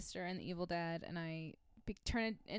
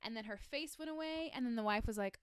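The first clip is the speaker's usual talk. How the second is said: normal talking voice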